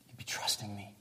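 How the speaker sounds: breathy whispered plea. Retold or imitated. Jesus urging the crowd to trust him, whispering. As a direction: whisper it close, like a secret.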